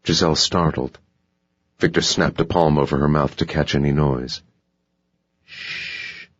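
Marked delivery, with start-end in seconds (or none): 5.4-6.3 voiceless breath of a shush; mouthed shush. Acted. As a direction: hush her without any voice. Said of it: "he mouthed".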